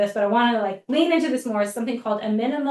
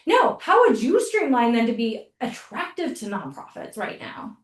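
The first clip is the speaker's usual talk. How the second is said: ordinary talk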